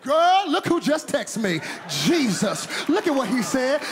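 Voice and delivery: loud, high, laughing joy; excited Martha. Acted. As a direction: shout the good news, overjoyed. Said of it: "Martha gets the text".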